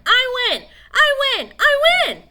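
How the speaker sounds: very high, loud, building victory cry; Billy shouting his win; triumphant yelling. Acted. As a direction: scream your win.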